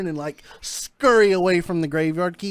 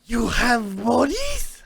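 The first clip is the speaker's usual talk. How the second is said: eager scientist voice; 4 semitones higher, much slower than usual. Acted. Scientist NPC thrilled to hear of bodies, slow and stretched. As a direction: drag out the question, hungry for bodies.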